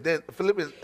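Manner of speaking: ordinary talk, nothing marked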